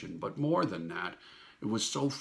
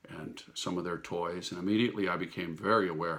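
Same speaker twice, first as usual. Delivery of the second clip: usual speaking voice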